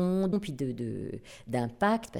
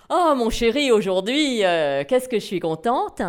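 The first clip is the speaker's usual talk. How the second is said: high and loud happy gush; excited gushing; 4 semitones higher, 6 dB louder than usual. Shown as a demonstration. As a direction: gush happily to your darling. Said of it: "on passe de cette petite voix ... à".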